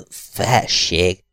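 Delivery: faltering, toneless address; colourless stammer. A narrator's reading. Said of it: "hebegte szintelen hangon". (színtelen hangon hebegve)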